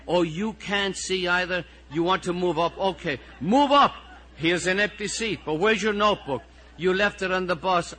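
high, loud, mounting exasperation; exasperated teacher. Acted. Teacher moving a pupil to an empty seat, exasperated.

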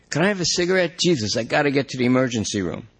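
high, fast, fretful whine; anxious junkie. Acted. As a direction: panic about where she went. Lester begs cigarette.

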